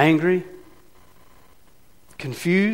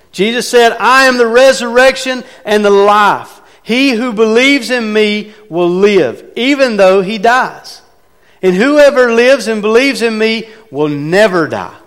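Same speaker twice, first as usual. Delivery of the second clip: much higher and louder, slowing on the promise; preaching at full force; 7 semitones higher, 7 dB louder than usual. Acted. Preacher booming Jesus's promise of life to the congregation. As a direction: proclaim it to the whole church.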